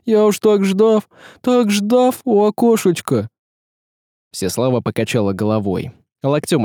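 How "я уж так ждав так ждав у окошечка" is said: high, drawn-out plaintive voice; lamenting character. Acted. Character at the window who waited so long, lamenting. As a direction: plead it slowly, pitched well up.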